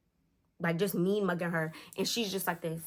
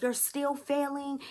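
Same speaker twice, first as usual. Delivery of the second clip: high, drawn-out reproach; imitated exasperated stepdad; scolding; 7 semitones higher, slower than usual. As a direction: stretch it slowly in a raised pitch. Stepfather scolds girl in story.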